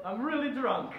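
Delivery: put-on laughing, loud hammy Italian accent; comical Italian accent. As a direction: ham up a drunk Italian accent. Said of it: "comical Italian accent".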